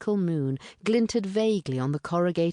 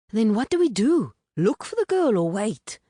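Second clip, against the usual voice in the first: louder, higher impatient man; impatient stranger; 7 semitones higher, 6 dB louder than usual. A narrator's reading. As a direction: push them for a decision. Stranger asking the others.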